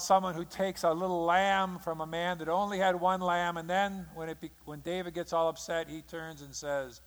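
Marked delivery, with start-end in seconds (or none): none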